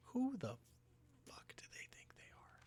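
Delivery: indignant whisper; whispered indignation; whispered outrage. Real, not acted. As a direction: hiss the outrage under your breath. Indignant aside at characters.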